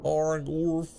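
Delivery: a garbled beastly grunt; dinosaur grunt. Pretend. Dinosaur player roars in character.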